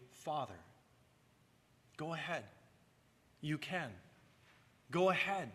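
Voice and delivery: put-on quiet, encouraging "Go ahead", like urging a shy child; gentle invitation. Shown as a demonstration. Preacher gently invites listeners to pray "Father", softly. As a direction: softly coax the congregation to say "Father". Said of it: "When you pray, say".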